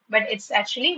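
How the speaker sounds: ordinary talk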